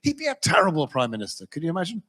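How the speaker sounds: ordinary talk, nothing marked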